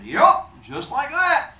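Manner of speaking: high, loud, drawn-out encouragement; cheery approval. Acted. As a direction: stretch it out in a high, eager voice. Hephaestus coaches leaning Zeus.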